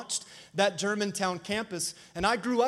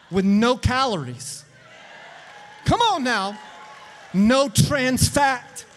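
full-force preaching; 6 semitones higher, 8 dB louder than usual. Real, not acted. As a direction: hype up the congregation, stretching key words.

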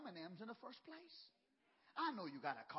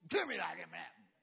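macho daddy; 5 semitones lower, 7 dB louder than usual. Retold or imitated. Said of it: "I'm macho, I'm big".

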